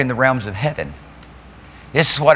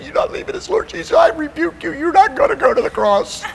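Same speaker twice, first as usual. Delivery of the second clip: very high, hammed-up protest; Peter. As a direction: scold in a comically high put-on voice. Acting Peter rebuking Jesus out of compassion.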